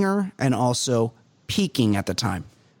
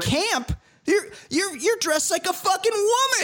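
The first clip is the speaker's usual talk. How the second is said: mock [name]. Mocking [name]'s retort.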